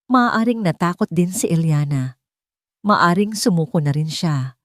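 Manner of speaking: plain reading voice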